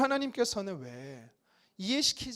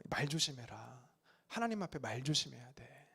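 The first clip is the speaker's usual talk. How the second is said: softer, lower mild warning from the elders; a hint of an old elder cautioning; elders' warning; 7 semitones lower, 10 dB quieter than usual. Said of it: "어르신들이 저에게 이런 말씀". (어르신이 아이에게 조용히 타이르는 경고)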